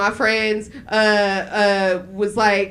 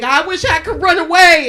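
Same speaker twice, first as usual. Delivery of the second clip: put-on high, loud child's complaint; mock tearful outburst; 7 semitones higher, 7 dB louder than usual. Child yelling at mom in a family fight, mock wail. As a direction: wail it as a fed-up kid, hammed up. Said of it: "And then we'd all cry".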